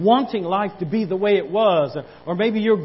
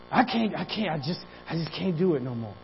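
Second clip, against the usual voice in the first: softer, hurried, worn-out giving up; defeated sigh; 5 dB quieter than usual. Acted. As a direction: mutter it like someone who's given up. Voicing a defeated believer.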